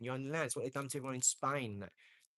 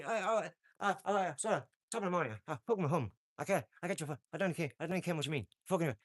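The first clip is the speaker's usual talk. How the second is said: higher-pitched, hammed-up Irish accent; mock Irish accent; 4 semitones higher, faster than usual. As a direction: put on a stage Irish brogue. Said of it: "And if I went".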